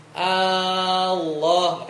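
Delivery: "Allah" with a long held first A; drawn-out A. Shown as a demonstration. Teacher demonstrates the wrong drawn-out "Allah" for students. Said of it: "kalau dipanjangin bacanya".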